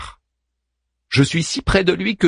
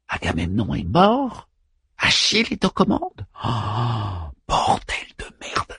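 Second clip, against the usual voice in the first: stunned; 4 dB quieter than usual. Real, not acted. Stupeur murmurée du narrateur.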